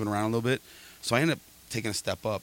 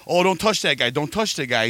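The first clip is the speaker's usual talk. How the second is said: louder, higher called-out warning; mock warning call; 5 semitones higher, 5 dB louder than usual. Telling the dudes not to touch the passing guy, louder mock call.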